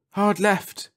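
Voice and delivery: high, eager call. Acted. Leakey calling to Finbold, high and eager. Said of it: "called Leakey".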